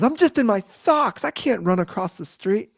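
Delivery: high-pitched mock whining protest. Neighbor stuck in socks as his dog runs off, high mock protest. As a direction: complain in a very high imitated voice. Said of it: "And he goes".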